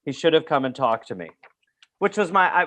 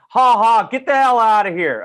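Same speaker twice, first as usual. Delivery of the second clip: mock teacher laugh-yell; 7 semitones higher, 6 dB louder than usual. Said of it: "And he'd be like".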